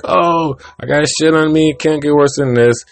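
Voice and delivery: whiny bird. Retold or imitated. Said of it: "he's like".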